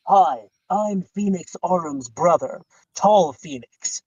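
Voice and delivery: low, very slow put-on voice; badly disguise yourself by dropping your voice; mock introduction, deep and drawn out; fake brother in disguise. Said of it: "have someone show up with a Groucho Marx".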